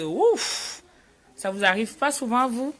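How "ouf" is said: mock outcry. Shown as a demonstration. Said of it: "qu'on a envie de".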